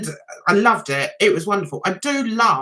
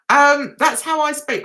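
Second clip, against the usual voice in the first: ordinary talk, nothing marked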